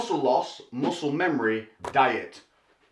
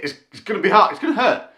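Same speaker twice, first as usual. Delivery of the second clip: laughing; 5 semitones higher, 6 dB louder, faster than usual. Real, not acted. Laughing through warning.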